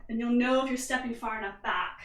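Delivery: ordinary delivery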